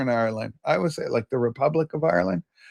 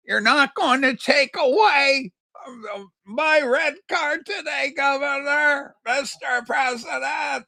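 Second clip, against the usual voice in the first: higher, slow mock plea to the Governor; mock pleading; 9 semitones higher, slower than usual. To the commenter, a lightly hammed-up slow appeal. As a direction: draw it out like a playful petition.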